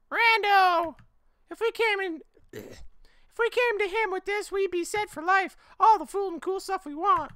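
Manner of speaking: very high, loud scheming squeal; squeaky schemer. Acted. Friend pitching to sell the baby, high squeal. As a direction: pitch it way up, plotting.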